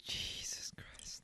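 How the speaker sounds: breathy whispered aside; slow, under-the-breath whisper. Real, not acted. Muttered reaction to eating.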